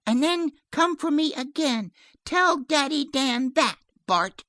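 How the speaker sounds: put-on high, loud child's voice; commanding little girl. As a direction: instruct the dog as an insistent child. Child gives the dog orders.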